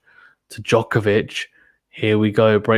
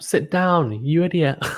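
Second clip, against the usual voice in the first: lightly voiced heckler, a little higher; mock heckle; 6 semitones higher, slower than usual. Commentator mock-heckling a fan who stands up. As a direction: tell the standing fan to sit down. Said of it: "Someone in the crowd is standing up".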